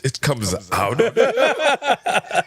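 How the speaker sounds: high, slow disbelieving question; mock surprised. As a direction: act out the shocked question. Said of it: "they were like".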